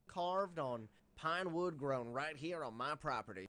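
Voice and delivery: mock Southern accent; exaggerated Southern countryman accent. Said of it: "do that accent".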